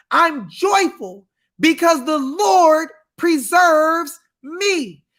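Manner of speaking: ordinary talk, nothing marked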